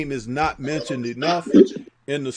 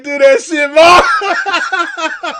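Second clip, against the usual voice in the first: loud, higher cheer; cheering shout; 10 semitones higher, 8 dB louder, much slower than usual. Retold or imitated. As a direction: celebrate him at full voice. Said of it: "I was like".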